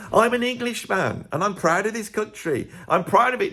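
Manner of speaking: mock-patriotic declaration, chest out; proud Englishman. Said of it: "Englishmen don't show chest".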